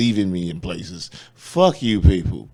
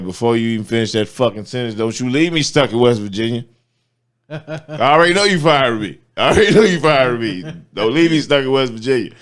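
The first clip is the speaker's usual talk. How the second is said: retold angry rant; 4 semitones higher, 6 dB louder than usual. Retold angry outburst on phone.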